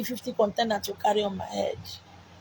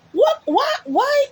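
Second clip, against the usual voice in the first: very high, loud shocked what and why; high-pitched incredulous outcry; incredulous cry; 5 dB louder, slower than usual. Acted. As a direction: shriek the questions in disbelief. Preacher cries out questions.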